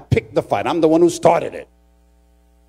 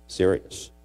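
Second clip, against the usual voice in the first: ordinary talk, nothing marked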